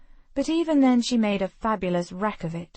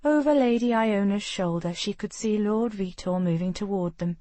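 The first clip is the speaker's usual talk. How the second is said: ordinary delivery, nothing marked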